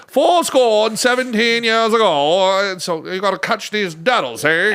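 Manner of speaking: a hint of a Victorian accent, slow start; lightly mock Victorian accent. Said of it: "we have to say this in a Victorian accent".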